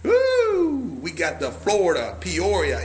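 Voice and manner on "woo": high cheering whoop. Real, not acted. Seller whooping at viewers from everywhere, joyful cry. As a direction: let out a big celebratory woo.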